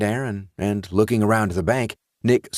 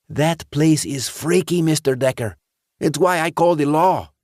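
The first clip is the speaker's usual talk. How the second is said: put-on higher, eager voice of a worried teenage boy; anxious teenage boy; 8 semitones higher, 4 dB louder than usual. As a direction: play the nervous teen insisting to the sheriff. Teen character voiced by narrator.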